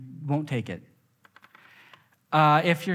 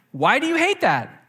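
exasperated wife; 10 semitones higher, 6 dB louder than usual. Retold or imitated.

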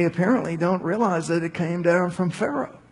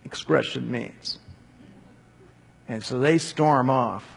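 usual speaking voice

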